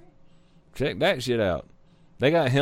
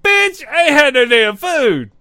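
cat's retort; 10 semitones higher, 7 dB louder than usual. Pretend.

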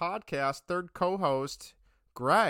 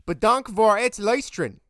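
Dutch; 5 semitones higher, 10 dB louder than usual. Shown as a demonstration. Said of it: "I'm gonna try my best in Dutch to say thanks for listening".

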